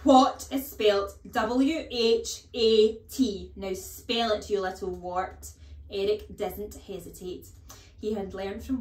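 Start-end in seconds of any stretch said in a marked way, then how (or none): 0.0-5.5 loud, slow spelling bellowed; shouting. Acted. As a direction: drum the letters in. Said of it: "The Trunchbull was shouting".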